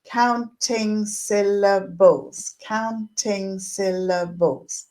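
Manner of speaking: slow, syllable by syllable, "Counting syllables" split into its five beats; clapped syllables. Shown as a demonstration. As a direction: clap out "counting syllables" beat by beat, slowly. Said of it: "we'd say".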